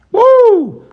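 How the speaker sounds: high, loud "woo"; mocking whoop. Real, not acted. Pastor reacting to a rival's threat, high mocking whoop. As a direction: let out a high mock-scared whoop.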